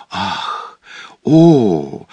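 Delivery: mild amazed gasp and cry. A narrator's reading. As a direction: breathe in wonder, then exclaim. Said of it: "голос был изумлённый".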